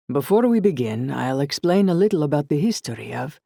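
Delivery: put-on light Italian shopkeeper accent; a friendly Italian welcome, lightly voiced. Glassblower welcoming guests at the door, light Italian accent. Raphael begins demo.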